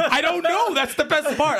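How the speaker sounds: laughing. Real, not acted. Still laughing at the joke.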